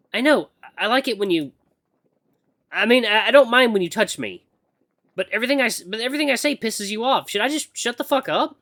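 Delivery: high, halting and exasperated; flustered heroine. Acted. Acolyte confessing to her moody mentor, flustered.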